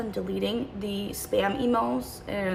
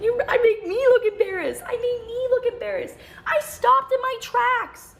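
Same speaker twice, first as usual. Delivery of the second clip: much higher mocking parody of his words; mocking repeat; 4 dB louder than usual. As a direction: throw his line back, mocking and amused.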